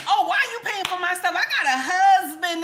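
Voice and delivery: high, quick, offended protest; mock indignation. Mimicking someone refusing a stranger's payment, mock indignant. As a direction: act affronted by a kind offer.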